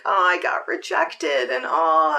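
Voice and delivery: deflated. Retold or imitated. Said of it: "the three D stuff kinda hit me".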